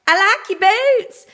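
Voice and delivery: imitating the stranger. Man at the gas station hitting on her, high slow mock voice. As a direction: drawl it up high like a leering stranger. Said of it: "and he said".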